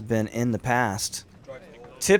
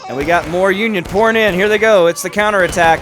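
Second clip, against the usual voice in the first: louder, higher play-by-play burst; excited commentary; 5 semitones higher, 8 dB louder, faster than usual. Real, not acted. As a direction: call the counterattack as it breaks.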